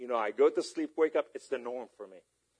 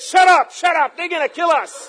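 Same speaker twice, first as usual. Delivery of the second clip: high, loud imitated alarm; urgent acted yell; panicked shout; 15 dB louder than usual. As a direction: shout him down as the panicked woman. Acts out her panicked hushing.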